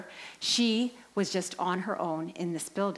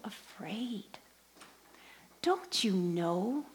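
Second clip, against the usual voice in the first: tender reassurance; much slower than usual. Retold or imitated. Priest holding the frightened woman's hands, tender reassurance. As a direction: reassure a frightened child, lingering on each phrase.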